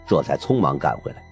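plain reading voice

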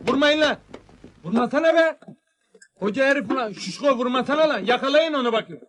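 high-pitched, agitated pleading to stop; agitated protest. Acted. Man being hit tells them to stop, agitated protest. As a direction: cry out against the blows, pitch up.

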